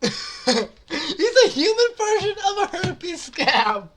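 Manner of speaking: high, loud, laughing insult; laughing hard while talking. Real, not acted. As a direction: squeeze the words out through real laughter. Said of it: "I'm laughing".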